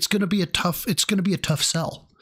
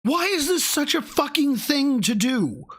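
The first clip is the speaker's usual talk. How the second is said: exasperated; 12 semitones higher than usual. Real, not acted. Exasperated at the tip fuss.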